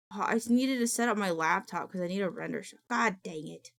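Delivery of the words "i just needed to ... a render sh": ordinary talk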